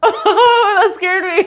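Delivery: real excited, laughing relief; giddy fright. Real, not acted. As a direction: still buzzing from the jolt. Laughing after being startled.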